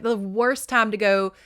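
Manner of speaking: ordinary delivery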